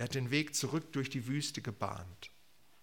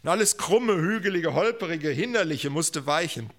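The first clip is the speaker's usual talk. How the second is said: loud, raised opening, easing slightly; forceful preaching; 5 semitones higher, 7 dB louder than usual. Real, not acted. Preacher declares every obstacle gone, forcefully. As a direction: hammer out each adjective.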